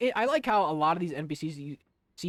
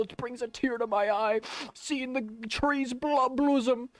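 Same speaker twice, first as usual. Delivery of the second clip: mock poetic; 8 semitones higher, slower than usual. Mock poetic over robins outside.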